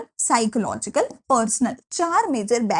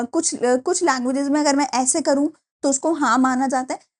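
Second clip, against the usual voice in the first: ordinary talk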